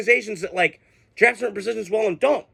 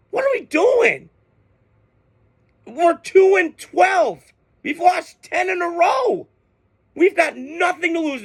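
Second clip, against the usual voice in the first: real angry shout; 8 semitones higher, slower than usual. Real, not acted. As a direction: shout in frustration at your own team. Furious rant about his team.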